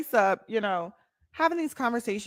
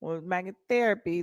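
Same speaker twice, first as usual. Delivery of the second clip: normal talking voice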